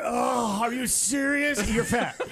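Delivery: high, drawn-out groaning disbelief; comic put-upon complaint; exasperated dismay. Retold or imitated. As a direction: play a seatmate dreading the squeeze, slowly. Acts dismayed seatmate seeing fat passenger.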